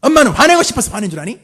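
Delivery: put-on an angry mother screaming at her child; shouting mother. Shown as a demonstration. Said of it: "따라하지 말고 저 혼자 할게요". (소리 지르는 엄마)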